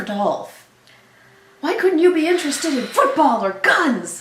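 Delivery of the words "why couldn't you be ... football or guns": disappointed dad voice. Acted. Voicing Dolph's disappointed dad.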